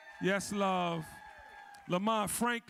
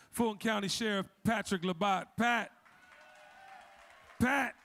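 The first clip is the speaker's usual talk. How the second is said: ordinary talk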